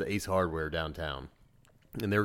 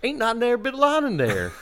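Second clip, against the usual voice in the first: high, loud imitation Southern twang; thick Southern dialect; 6 dB louder than usual. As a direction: repeat it louder in a run-together Southern accent.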